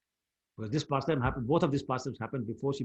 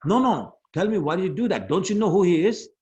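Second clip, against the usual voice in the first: louder, higher indignant challenge; angry protest; 5 semitones higher, 6 dB louder than usual. Retold or imitated. Brother defending Krishna to mother, acted anger. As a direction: press the question in acted outrage. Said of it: "in great anger".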